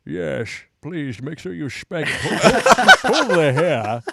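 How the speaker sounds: a lightly put-on Sean Connery-style accent, slow; a hint of a Sean Connery accent, half laughing. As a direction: play a Chinese girl with a Scottish accent. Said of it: "she's like".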